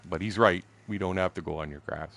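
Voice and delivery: ordinary delivery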